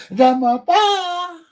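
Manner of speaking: high, slow, stretched exclamation; loud mock wail on a high note; loud drawn-out cry. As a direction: belt it out, stretching PA. Repeats the answer, drawn out loud.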